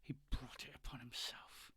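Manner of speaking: hushed retort from a pinned man; quiet, breath-only answer; whispered growl. Acted. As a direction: whisper it back at your attacker. Pinned character answers attacker.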